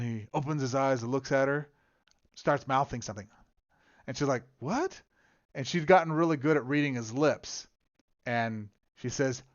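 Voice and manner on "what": a high, sharp "What?"; puzzled question. Retold or imitated. Sister puzzled as her father mouths something, high. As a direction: shoot the pitch up in confusion.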